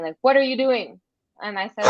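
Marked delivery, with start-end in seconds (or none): 0.2-1.0 mild mock yell, higher and louder; retold yell. Woman retelling a bus driver yelling at her, mild mock yell. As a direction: imitate an angry driver's yell, held back. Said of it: "he yelled at me".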